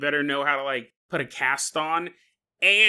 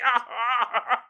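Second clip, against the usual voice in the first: pained patient groans; 7 semitones higher than usual. Retold or imitated. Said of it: "He's like".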